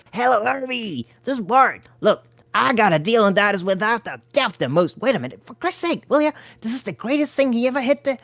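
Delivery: lightly voiced hustler. A narrator's reading. Bart pitching to Herbie.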